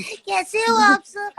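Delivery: put-on high-pitched, drawn-out little girl voice. Shown as a demonstration. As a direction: put on Lalli's childlike voice, high and slow. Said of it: "एक बच्ची की आवाज से किया था". (ऊँची, धीमी बच्ची की आवाज़)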